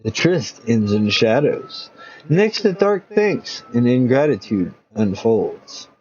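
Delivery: slow hushed trailer read; dramatic teaser. Acted. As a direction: pull the listener into the next chapter slowly. Teaser narration.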